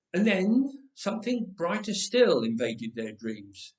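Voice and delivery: ordinary delivery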